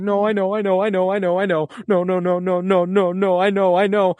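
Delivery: a father refusing to accept it; imitating Joel's desperate denial. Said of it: "and he's like".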